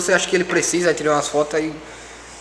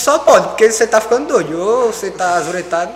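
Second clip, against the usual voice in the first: mock exasperation; 4 semitones higher, 6 dB louder than usual. Retold argument with friend.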